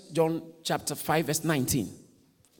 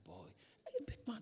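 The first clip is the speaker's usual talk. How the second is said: gentle whisper; 19 dB quieter than usual. Retold or imitated. Pastor hugging crying brother.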